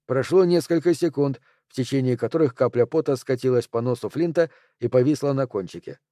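ordinary delivery

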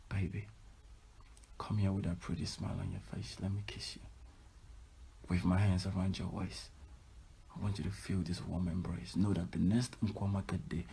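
soft, tender romantic coaxing; sweet-talking boyfriend. Shown as a demonstration. Showing men how to comfort a tired girlfriend, tenderly soft. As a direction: murmur it softly to a sweetheart. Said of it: "then you go in and be like".

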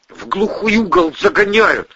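high, tense complaint; cornered and grim, pitched high; forced out tensely. A narrator's reading. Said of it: "процедил Андрей".

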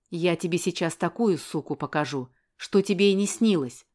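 plain reading voice

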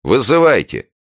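high, loud dare; defiant shout. A narrator's reading. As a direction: call the neighbour's bluff loudly. Tanya shouts back at neighbour. (с вызовом, громко)

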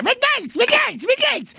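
high mocking stammer of the caller; stammering mockery. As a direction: squeal it like a stammering ranter. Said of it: "balbetta e parla in quel modo là".

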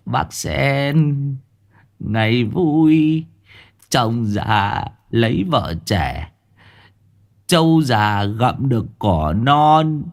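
slow, high singsong with drawn-out words; giggling village fool. A narrator's reading. Village madman teasing Bác Sen, giggling singsong. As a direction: chant it like a simple-minded tease. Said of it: "Nó quay lại cười hề hề".

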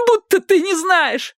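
very high, slow tearful voice; sobbing accusation; tearful retort. A narrator's reading. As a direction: wail it accusingly in a high girl's voice. Sobbing girl answers her captor.

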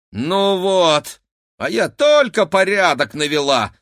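high, loud, outraged complaint; indignant. A narrator's reading. Cleaning woman finds her tidying undone, indignant. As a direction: protest loudly in a high woman's voice. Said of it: "возмущённо бросила она".

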